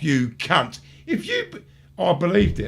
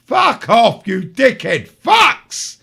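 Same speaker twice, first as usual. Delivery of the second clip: loud, slow furious yell; angry shout; 2 semitones higher, 5 dB louder, much slower than usual. Real, not acted. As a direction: bellow it at the idiots. Ranting at conspiracy theorists.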